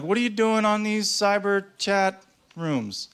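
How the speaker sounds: high, slow, incredulous questioning; mock confrontation. Boyfriend asking girlfriend about chat rooms, accusing. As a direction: confront a partner, drawing out the words.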